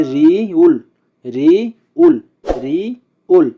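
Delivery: রি উল slowly, রি held, pause, then উল; pronunciation drill. Shown as a demonstration. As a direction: model রি উল in two slow beats. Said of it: "আমরা আবার একসাথে উচ্চারণ করি". (রি উল ধীরে ভেঙে উচ্চারণ)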